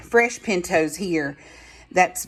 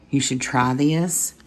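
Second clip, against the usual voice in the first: ordinary talk, nothing marked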